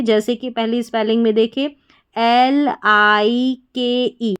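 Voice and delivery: ordinary delivery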